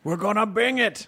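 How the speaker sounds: put-on British Luddite war cry; mock British growl. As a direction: parody a growly British rallying yell. Parodies the growly British Luddite line.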